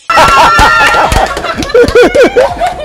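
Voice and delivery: high, loud whooping laugh; real burst of laughing joy; shrieking laugh. Real, not acted. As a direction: shout out a delighted laugh. Second winner announced live.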